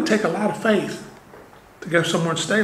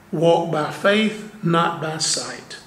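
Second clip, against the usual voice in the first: slow emphasis; 2 semitones lower, slower than usual. Acted. Quoting a scripture motto.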